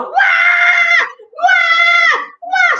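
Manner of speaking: very high, loud wahs; piercing imitated baby cries; baby wailing. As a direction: wail like a startled baby. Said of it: "made the baby go".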